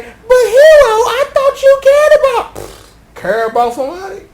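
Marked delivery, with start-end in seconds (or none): 0.0-2.5 high, louder whiny plea; pleading high girl. Acted. Poppy begging Hero to care, high and loud. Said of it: "Poppy sit up here like".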